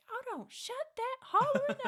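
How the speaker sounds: very high, loud angry yell; mama yelling. Retold or imitated. Mother throwing the door open to yell at giggling kids. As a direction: holler at the kids to hush.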